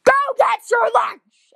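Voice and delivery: high, loud mock scream; screamed order. Lunch aide orders a boy to fetch lunch, screaming. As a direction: scream it right into someone's ear. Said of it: "and she just screams".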